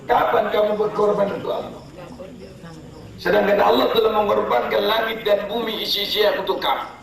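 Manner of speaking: high, pressing rhetorical questions; preacher challenging the crowd; fiery preaching. Real, not acted. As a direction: hammer the congregation.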